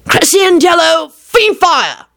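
high, slow incantation shout; shouted spell. A narrator's reading. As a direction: call the spell out at a stove. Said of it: "Lupin shouted at the stove".

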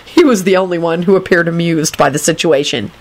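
ordinary delivery

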